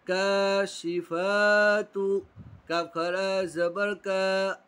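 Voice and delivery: slow, drawn-out recitation of syllables; Quran spelling drill. Shown as a demonstration. Teacher drilling pupils in Quran spelling, slow chant. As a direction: spell it out syllable by syllable, stretching long vowels.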